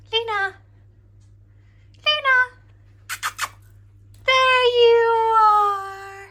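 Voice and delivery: calling out. Real, not acted. Calling the cat upstairs.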